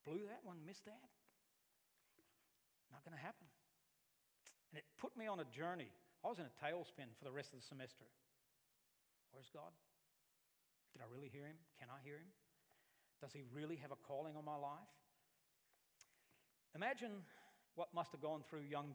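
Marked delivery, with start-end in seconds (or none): 0.0-3.5 much softer, defeated murmur; student leaving the office heartbroken; dejected mutter. Retold or imitated. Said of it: "walked out of there going". 9.3-14.6 doubting questions. Retold or imitated. Crisis of faith questions.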